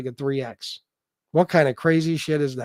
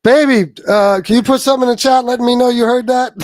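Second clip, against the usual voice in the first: loud, higher anxious request; loud urgent ask; 8 semitones higher, 10 dB louder than usual. Real, not acted. Husband loudly and anxiously asks wife to answer in chat. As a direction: call out to her urgently.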